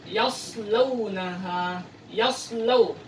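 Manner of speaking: "yaslaunaha" drawn out slowly; slow recitation; slow Quranic reading. Shown as a demonstration. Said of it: "Untuk huruf shad".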